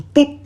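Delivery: cartoon pop noise for a Lego arm; playful pop sound.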